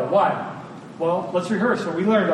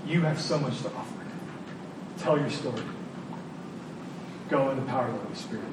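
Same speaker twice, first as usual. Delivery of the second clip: soft fast voice of God; 5 semitones lower, 10 dB quieter, much faster than usual. Acted. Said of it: "God's mission says".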